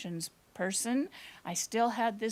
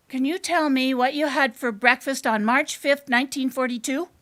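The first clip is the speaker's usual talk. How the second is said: loud, brisk quiz question; louder, quickening question to a stranger; blurted question; 3 semitones higher, 6 dB louder than usual. Acted. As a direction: fire off the whole question loudly. Child puts the question at last.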